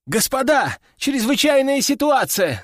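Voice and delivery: high-pitched excited outburst; squealing alarm. A narrator's reading. Duty soldier bursts into headquarters with news, squealing alarm. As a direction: blurt it out, voice shooting up. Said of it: "заверещал паренёк".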